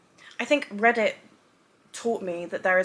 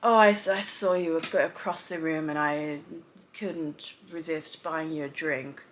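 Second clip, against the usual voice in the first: cheesy smooth pickup; 5 semitones lower, slower than usual. Retold or imitated. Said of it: "something that's not like".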